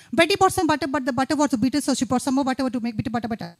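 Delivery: very fast, rushed tongue twister. Shown as a demonstration. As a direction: race through the words as fast as you can. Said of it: "It has to be fast".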